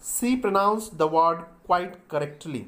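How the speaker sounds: sentence read out very slowly word by word; slow, deliberate classroom dictation. Shown as a demonstration. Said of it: "तीसरा sentence और देखते हैं हम एक".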